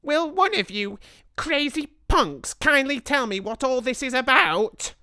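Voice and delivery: put-on high, loud, indignant boy; boy's shout. A narrator's reading. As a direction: Bruno yelling at the crazy witches. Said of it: "shouted Bruno".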